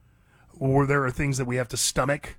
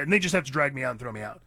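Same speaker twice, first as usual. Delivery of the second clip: usual speaking voice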